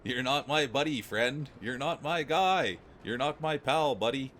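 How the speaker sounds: playful squaring-off, stretching the end of each comeback; real Canadian. Acted. Streamer acting out a Canadian buddy-friend-guy spat, mock confrontational. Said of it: "this is a real Canadian".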